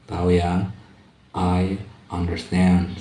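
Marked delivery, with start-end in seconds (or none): 1.3-3.0 stretched out, each word clear; slow teacher demo; slow model phrase. Shown as a demonstration. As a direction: say it slowly for students to copy. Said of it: "Saya mengerti".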